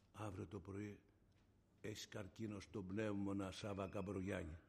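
low, slow and very soft; grave hushed news. Retold or imitated. Retold shock of cancer diagnosis.

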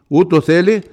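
loud, high sermon climax; impassioned proclamation, loud and high; full-force preaching. Acted. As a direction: preach it out to the whole church.

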